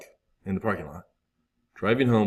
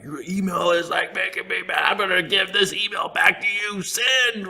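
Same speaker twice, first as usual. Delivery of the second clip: higher, hammed-up indignant shout; mock angry reply; 10 semitones higher than usual. A man angrily answers a stranger's rude email, mock shout. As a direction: fire back at the sender in mock fury.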